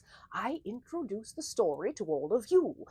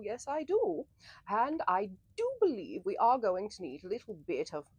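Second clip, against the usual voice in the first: ordinary delivery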